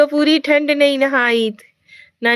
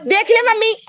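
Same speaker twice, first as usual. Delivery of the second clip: calling out; 10 semitones higher than usual. Real, not acted. Calling mother, complaining.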